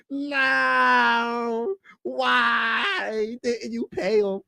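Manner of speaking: high slow wailing plea; crying out. Real, not acted. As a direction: wail it at the team, heartbroken. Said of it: "I'm sad about that".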